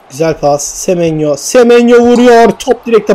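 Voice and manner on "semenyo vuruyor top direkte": higher and louder, urgent; sudden lift as the shot comes; excited shot call. Real, not acted. Commentator calling Semenyo's shot, excited.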